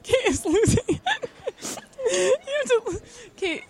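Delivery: high voice breaking into laughter; laughing hard. Real, not acted. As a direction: talk through helpless giggles. Laughing fit after a joke.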